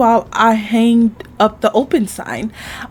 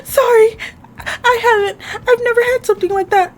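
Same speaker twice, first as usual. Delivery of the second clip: high, tearful apology; flustered; 14 semitones higher than usual. Acted. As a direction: panicky little character voice, pitched way up. Flustered Carrie admits not knowing.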